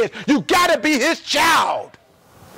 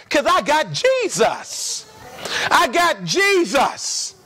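slow drawn refrain; slower than usual. Acted. Preacher drawing out refrain.

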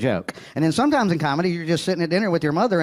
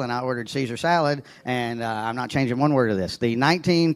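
ordinary talk, nothing marked

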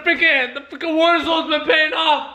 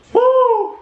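loud, high "woo"; celebratory whoop; 10 semitones higher, 5 dB louder than usual. Real, not acted. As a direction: whoop loudly for the team. Celebrating the lead.

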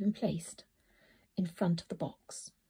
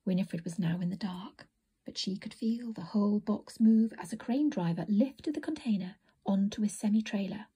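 ordinary delivery, nothing marked

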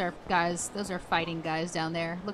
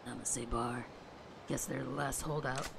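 low gruff tough guy voice; deep stoic yakuza growl; Kiryu voice; 7 semitones lower, 5 dB quieter than usual. Acted. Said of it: "This voice that I've given Kiryu".

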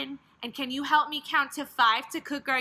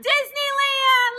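excited; 8 semitones higher, 5 dB louder than usual. Acted. Excited echo of child's answer.

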